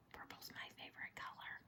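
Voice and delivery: breathy secret whisper; soft whispered aside. Pretend.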